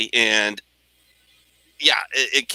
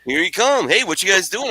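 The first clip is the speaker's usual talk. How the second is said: louder, higher, faster complaint; exasperated rant; 9 semitones higher, 8 dB louder, faster than usual. Retold or imitated. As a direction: vent it in a rush. Complaining to buddy about interruptions.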